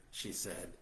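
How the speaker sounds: plain reading voice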